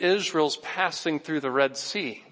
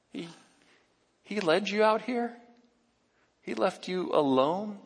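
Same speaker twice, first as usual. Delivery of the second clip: a light, higher, coaxing tempter voice; voicing the devil; 4 semitones higher than usual. Preacher voicing the devil to hungry Jesus, coaxing. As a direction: whisper the lure like a sly tempter. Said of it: "what the devil is saying to him subtly".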